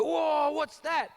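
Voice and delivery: high, loud, slow awed whoa; amazed kids. Retold or imitated. Classmates gasp at his bruise in awe. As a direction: gasp out in wonder like a child. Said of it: "And all the kids would go".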